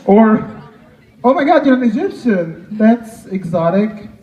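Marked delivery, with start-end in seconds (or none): none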